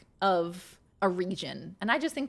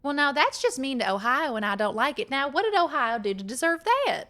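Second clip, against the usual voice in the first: higher, playfully offended Southern twang; mock Southern indignation; 5 semitones higher, 4 dB louder than usual. Streamer sticking up for Ohio to chat, mock Southern indignation. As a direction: scold them with put-on Southern outrage.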